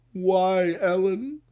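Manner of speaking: high, mock-anguished wail; hammed-up cry of loss. Mock lament for Ellen leaving.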